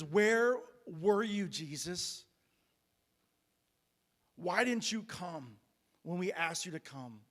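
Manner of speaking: acted hurt accusation, mildly heated; angry grieving demand. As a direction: plead angrily, stretching out the questions. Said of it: "and she's angry".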